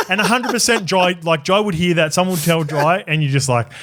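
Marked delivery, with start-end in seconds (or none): none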